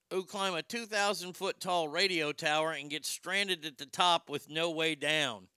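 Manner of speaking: usual speaking voice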